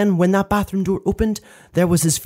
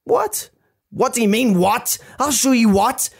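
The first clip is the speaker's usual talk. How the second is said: high startled question, then indignant retort; raised pitch, mum throwing the word back; acted quarrel; 6 semitones higher than usual. Furious mum answering her baffled son, high indignant scolding.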